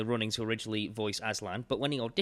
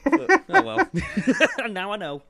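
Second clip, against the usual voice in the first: laughing; 12 semitones higher, 9 dB louder than usual. Real, not acted. Laughing at own trivia.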